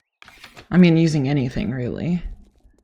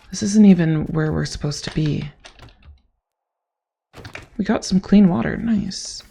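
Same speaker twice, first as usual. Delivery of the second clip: usual speaking voice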